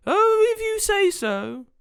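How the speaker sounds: very high, slow, loud sprite voice; compliant sugar sprite. Acted. Sprite answering the wizard's order, high drawn-out compliance. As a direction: give in with a high, drawn-out reply.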